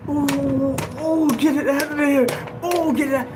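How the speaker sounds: high, pained moaning between the words; anguished cries. Retold or imitated. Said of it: "And you hear".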